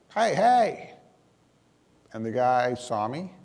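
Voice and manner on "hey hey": calling out. Retold or imitated. Yelling for the truck driver.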